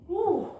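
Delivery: relieved high woo; high cheer. Real, not acted. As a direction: cheer at the finish. End of jumping set.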